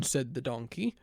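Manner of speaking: ordinary delivery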